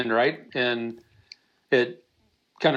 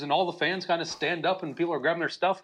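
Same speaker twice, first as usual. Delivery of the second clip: ordinary delivery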